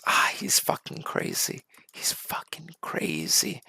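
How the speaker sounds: quiet, whispery muttering, slowing down; hushed disbelief. Acted. Guards talking about the screaming inmate, hushed disbelief. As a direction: whisper it, drawing out the repeat.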